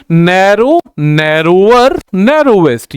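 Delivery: "Narrow", "narrower", "narrowest" slowly, each set apart; drawn-out word drill. Shown as a demonstration. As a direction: stretch the endings of "narrow" and "narrower". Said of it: "ऐसे ही". ("Narrow, narrower, narrowest" को खींचकर, अलग-अलग)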